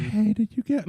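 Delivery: put-on high girl voice breaking into laughter; failed girl voice. Shown as a demonstration. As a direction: attempt a falsetto girlfriend and crack up. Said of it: "I can't even fucking try".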